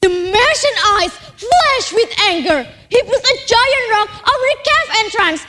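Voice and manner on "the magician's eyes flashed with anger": plain reading voice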